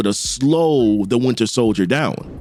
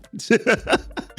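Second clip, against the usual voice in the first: short, breathy high chuckle; laugh; 6 semitones higher, 4 dB quieter than usual. Real, not acted. Host amused by flirty banter, chuckling. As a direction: laugh at your own bit.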